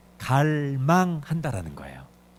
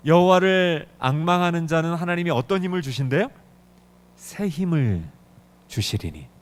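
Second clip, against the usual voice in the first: ordinary delivery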